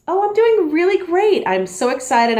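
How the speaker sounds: upbeat demo of an excited answer. Shown as a demonstration. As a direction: gush about how great you are. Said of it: "get people excited".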